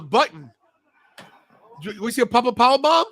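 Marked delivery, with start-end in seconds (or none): 1.8-3.1 louder and higher as the finish lands; excited shout. Real, not acted. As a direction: call the big finish like a hyped fan. Big finishing move live.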